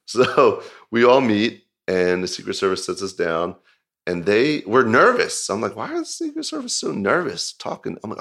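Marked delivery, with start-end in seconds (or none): 5.7-8.0 mock puzzled inner voice. Puzzled by nervous Secret Service agents, high baffled voice. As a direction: jump way up high and softer, baffled. Retelling his own puzzled thought.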